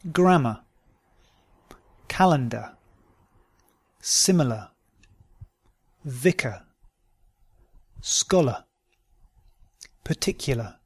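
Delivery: "Grammar" ending in "uh", R silent; British model words, "Calendar" as "calen-duh"; non-rhotic schwa ending. Shown as a demonstration. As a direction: drop the final R, as in "Solar" said "so-luh". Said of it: "endings that are pronounced simply as a".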